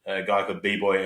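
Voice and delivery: normal talking voice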